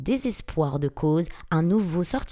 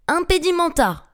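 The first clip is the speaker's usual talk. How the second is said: high, loud spell cry; urgent pitched-up shout; cried out; 10 semitones higher, 6 dB louder than usual. A narrator's reading. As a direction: shout the incantation at an attacking monster. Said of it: "s'écria Harry".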